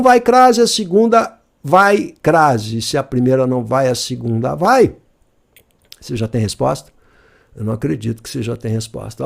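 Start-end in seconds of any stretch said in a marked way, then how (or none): 6.0-9.2 mock disbelief. Real, not acted. Teacher teasing fast students.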